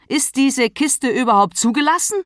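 high, loud, angry challenge; fauchend. A narrator's reading. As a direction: snap the question accusingly. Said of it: "Sie fauchte". (wütend angefaucht)

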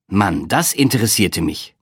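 ordinary delivery, nothing marked

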